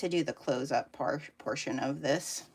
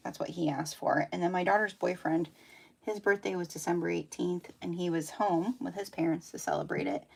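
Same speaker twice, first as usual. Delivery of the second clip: normal talking voice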